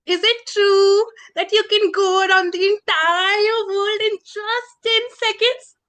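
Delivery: high, eager, awestruck question; wide-eyed flattery. Acted. Princess asking the dragon about flying, awestruck.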